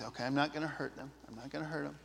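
quiet, fast self-talk, calming yourself down; talking yourself down under your breath; self-calming mutter. Retold or imitated. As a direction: hush it to yourself through clenched restraint.

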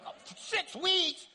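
high, loud, drawn-out mock panic; hammed-up shriek of alarm; mock freak-out. Pastor imitating his congregation hearing Christmas is near, mock freak-out. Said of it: "freaked out a bunch of you".

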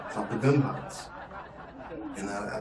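ordinary talk

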